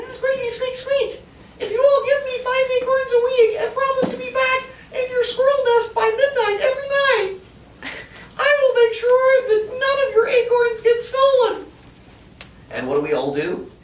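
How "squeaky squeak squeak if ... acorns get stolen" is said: very high squeaky squirrel voice making a promise; squirrel leader. Acted. Teacher voicing the squirrel leader to the class, very high squeak. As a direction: squeak it like a bossy little squirrel chief. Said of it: "And Alex says".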